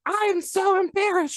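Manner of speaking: very high, loud mock whine; embarrassed wail. Chiropractor imitating the mortified patient, embarrassed high wail. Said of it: "She was as red as your dress".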